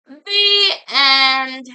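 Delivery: loud, drawn-out sign-off; grand ending. A narrator's reading. Narrator closing the squashed birds' tale, grand drawn-out finish. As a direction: stretch out both words to close with a flourish. Mock-grand close of the story.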